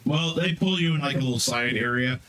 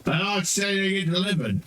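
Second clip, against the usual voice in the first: flustered character; 6 semitones higher than usual. Acted. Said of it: "Well, he's like".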